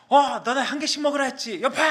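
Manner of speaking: high, strained mock outburst; angry coach scolding. Coach catching a student with extra cutlet, angry mock scolding. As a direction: imitate an irate coach pouncing on a culprit. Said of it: "제 뒤에서 화를 내시더라고요".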